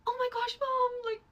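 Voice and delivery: high, loud, freaked-out cry; panicked wail to his mom; on verge of tears. Retold or imitated. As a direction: imitate a scared son about to cry. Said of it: "literally on the verge of tears".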